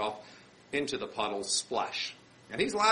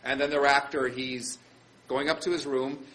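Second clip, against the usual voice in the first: normal talking voice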